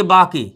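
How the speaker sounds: "बाके" misread as baa-kay; Hindi-style misreading. Shown as a demonstration. Teacher predicts the pupil's error, misreading "बाके" as baa-kay. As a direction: sound the final e in "बाके", after a long aa. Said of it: "आप पढ़ोगे".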